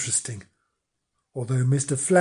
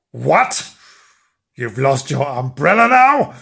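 loud, incredulous scolding; exasperated wifely outburst; outraged; 7 dB louder than usual. A narrator's reading. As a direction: burst out in loud disbelief. Wife outraged at lost umbrella.

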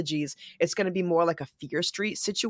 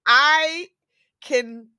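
mock relieved; 9 semitones higher, 5 dB louder than usual. Mock-resigned relief, loud.

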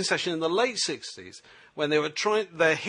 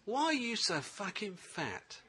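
much softer, slow, taunting jibe; sly sledge; 3 semitones higher, 11 dB quieter, slower than usual. Retold or imitated. Australian wicketkeeper taunting the batsman behind the stumps, soft and slow. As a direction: needle the batsman quietly and slowly.

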